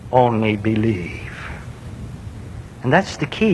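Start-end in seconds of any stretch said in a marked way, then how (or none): 0.1-1.3 gentle Jesus words. Retold or imitated. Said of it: "all he said".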